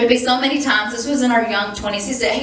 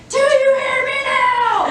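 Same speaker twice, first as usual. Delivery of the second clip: much higher, slow demanding yell; retold yell; 3 dB louder than usual. Wife yelling at her husband from in front of the TV, much higher. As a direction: plant yourself in front of the TV and yell it. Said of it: "I'd be like".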